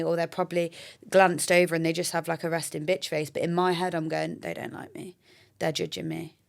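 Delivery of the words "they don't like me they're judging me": lightly acted worried aside, hushed; quiet inner thought. Thinking to herself at the gym, quiet worried voice. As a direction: drop to a soft thinking-to-yourself voice.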